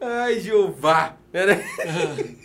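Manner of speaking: slow, high, breaking laugh-cry; words squeezed out through tears and giggles; crying with laughter. Real, not acted. Paulo, emotional over the surprise message, laughs and cries. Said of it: "Você tá chorando?".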